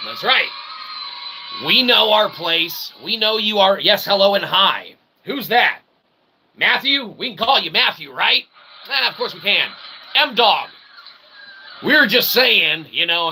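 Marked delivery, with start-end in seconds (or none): none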